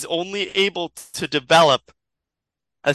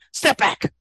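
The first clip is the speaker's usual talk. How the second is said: sudden high-pitched threat; acted warning; 10 semitones higher than usual. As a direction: warn them off in a raised pitch. Villain warns off her captors.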